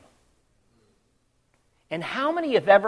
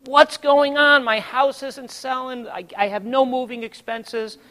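high, loud plea fading into complaint; crying out; 7 semitones higher, 9 dB louder than usual. Retold or imitated. Homeowner crying out to the Lord, desperate. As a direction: wail WHY upward, then list the troubles. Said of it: "I'm crying out to the Lord".

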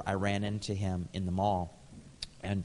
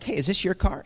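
a bright, much higher "hey" noticing the car; surprised question; 9 semitones higher than usual. Retold or imitated. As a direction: ask it like you just spotted something. Noticing friend's car in lot.

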